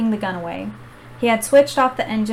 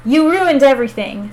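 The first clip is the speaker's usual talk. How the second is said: loud, furious howl, pitched up; 4 semitones higher, 7 dB louder than usual. Acted. Villain Sale howling at Alex in rage. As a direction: howl it at the boy who beat you. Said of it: "Sale howled".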